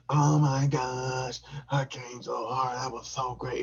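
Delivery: high drawn-out gushing; mock satisfied woman. Imitating his partner after, high gushing. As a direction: gush it in her voice. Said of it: "she was like".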